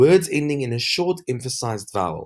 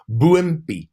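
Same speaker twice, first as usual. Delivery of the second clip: usual speaking voice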